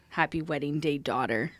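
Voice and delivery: quiet mocking murmur. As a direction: savor the joke under breath.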